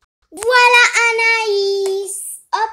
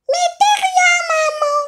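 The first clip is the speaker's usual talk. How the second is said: much higher, louder pony doll voice; Pinkie Pie doll voice; 12 semitones higher, 5 dB louder than usual. Pretend. Pony doll feeding the baby, high pony voice. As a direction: play the pony doll, pitched way up.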